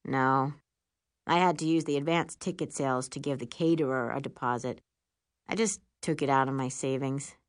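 deep, manly voice; Will. A narrator's reading. Boyfriend admitting he used his savings, deep and casual. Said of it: "says Will's deep, manly voice".

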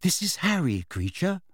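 tentative. A narrator's reading. Hermione introducing Harry to the elf, tentative. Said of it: "said Hermione tentatively".

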